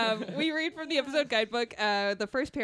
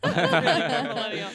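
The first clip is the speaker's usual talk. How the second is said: usual speaking voice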